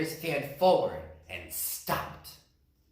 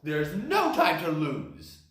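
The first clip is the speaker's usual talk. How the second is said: startled bear; 3 semitones higher, 4 dB louder than usual. Acted. Bear panics.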